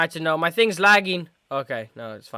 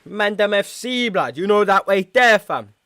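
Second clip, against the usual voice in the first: put-on animated London street-slang accent; raised, punchy voice in a mock street accent; 5 semitones higher, 5 dB louder than usual. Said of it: "Why did I do that ac-, accent".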